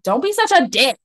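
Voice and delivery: acted-out exasperated outburst; retold loud outburst. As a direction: blurt it out like a retold telling-off. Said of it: "I just said it so loud".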